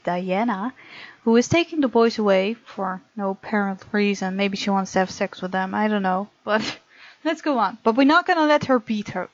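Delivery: normal talking voice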